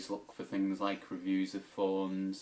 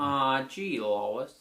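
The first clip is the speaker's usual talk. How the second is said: cartoon dad impression; 4 semitones higher, 4 dB louder, much slower than usual. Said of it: "do some impressions".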